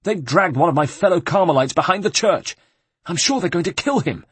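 high, anxious monk voice; a scared friar pleading for his brother, pitched high; frightened monk. Acted. The monk begs Ezio to save a fellow Carmelite, high and scared. Monk's fearful report.

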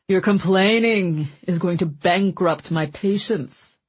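jovial glutton voice; Mancini voice. A narrator's reading. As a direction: boom it out like a greedy jester. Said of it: "Mancini shot back".

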